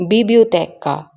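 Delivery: biblioteca with no L sound, bi-bio-te-ka; silent L. Shown as a demonstration. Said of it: "L is silent".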